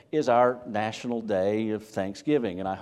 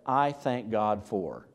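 slow deliberate thanks; 2 semitones lower, much slower than usual. Shown as a demonstration. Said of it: "eke it out of your mouth and just say".

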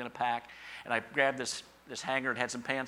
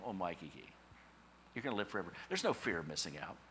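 ordinary delivery

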